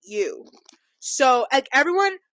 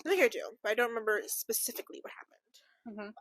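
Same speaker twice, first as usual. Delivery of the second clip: unvoiced whisper; 11 dB quieter, faster than usual. Real, not acted. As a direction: whisper it under your breath. Sudden whisper.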